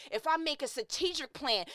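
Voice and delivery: usual speaking voice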